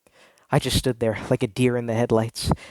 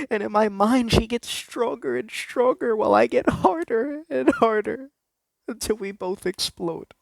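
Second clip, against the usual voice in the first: high strained excitement; 10 semitones higher than usual. Acted. Rising confession climax.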